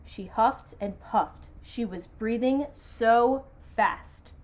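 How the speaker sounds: slow, emphatic storytelling; dramatic picture-book narration with stretched words; story read-aloud. A narrator's reading. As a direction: draw out each phrase for the children. Reads story to children.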